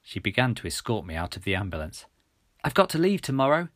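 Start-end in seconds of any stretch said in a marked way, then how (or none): 2.6-3.7 raised-pitch call; a restrained shout; calling back. A narrator's reading. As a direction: call out to a departing friend. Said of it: "I shouted back to Milly".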